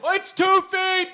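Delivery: high, slow mocking squeal; mock his proud claim, way up high; mocking quote. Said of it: "and you're like".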